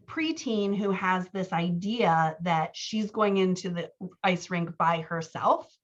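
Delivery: usual speaking voice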